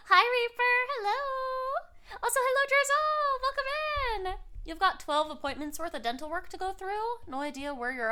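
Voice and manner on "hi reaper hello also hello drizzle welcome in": excited greeting. Real, not acted. Welcoming raiders on stream.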